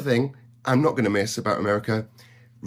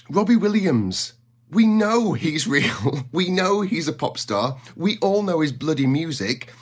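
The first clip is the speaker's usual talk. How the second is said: ordinary talk, nothing marked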